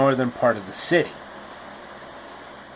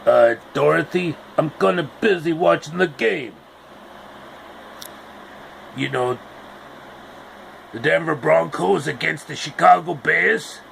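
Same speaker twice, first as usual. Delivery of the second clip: lightly put-on distracted guy; a hint of a brushed-off TV watcher; mildly voiced sports-fan dismissal; Tortellini voice. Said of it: "he basically told her".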